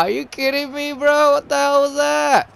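ordinary talk

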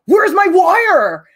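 loud, high, drawn-out angry demand; agitated mock yell. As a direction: imitate a classmate throwing a tantrum. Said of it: "getting more agitated".

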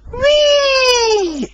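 high gleeful whee; cheering whee. A narrator's reading. Rosie splashing Toby, gleeful high whee. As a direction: squeal with joy. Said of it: "she cheered".

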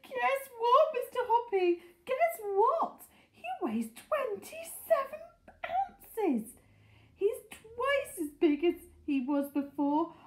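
high, exultant shout; thrilled, high-pitched announcing; excited shouting. A narrator's reading. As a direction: shout amazing news up to a neighbour. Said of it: "and shouting".

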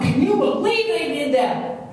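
high, drawn-out mock outrage; going off. Someone venting about others, high mock outrage. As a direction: raise the pitch in acted indignation. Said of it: "somebody's just going off".

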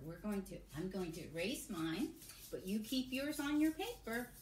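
normal talking voice

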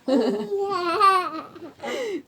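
tickled laugh. Real, not acted. Aunt tickled by child.

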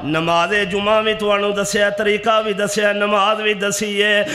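ringing, sustained preacher's delivery; full-force preaching. Real, not acted. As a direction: proclaim each point in drawn-out phrases.

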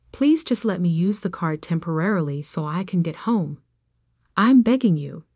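ordinary delivery

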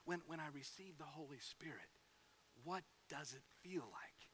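soft voiceless whisper from the baptistry; whispered question. Retold or imitated. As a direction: whisper it nervously in front of the crowd.

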